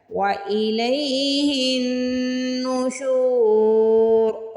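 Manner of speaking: नुशूर with a long drawn-out शू; chanted नुशूर, शू pulled long; stretched shu. Shown as a demonstration. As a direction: hold the शू vowel in नुशूर. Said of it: "शीन को खींच के अदा करना है".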